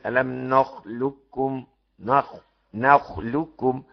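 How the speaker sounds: slow chanted نخلقكم with a doubled ك; slow reciter's نخلقكم; recited full assimilation. Shown as a demonstration. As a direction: recite نخلقكم slowly, ق fully merged. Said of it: "موافق على الإدغام الكامل".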